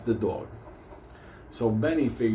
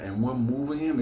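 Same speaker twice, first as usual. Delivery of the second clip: usual speaking voice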